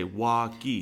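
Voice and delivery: "Walkie" with a w sound where an r belongs; child's "Walkie", w for r; says w for r. Retold or imitated. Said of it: "And you would say".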